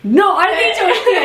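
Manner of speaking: high mock insistence, breaking into laughter; imitating indignant Gordon. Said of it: "está súper seguro y se enfada". (protesta fingida, aguda y entre risas)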